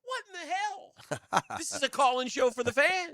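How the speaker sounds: exasperated radio host voice; Hacker impression. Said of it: "That's my Hacker impression".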